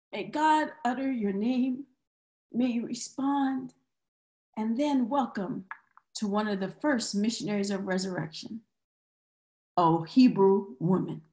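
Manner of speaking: gentle, hushed pastoral blessing; soft benediction. Real, not acted. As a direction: bless them softly, letting the pitch sink.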